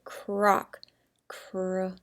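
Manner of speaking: "Croc" drawn out slowly, sound by sound. Shown as a demonstration. Teacher sounding out to spell for pupils, "Croc" stretched slowly. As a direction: slide through "Croc", stretching each sound softly. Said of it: "So let's say it, slide it".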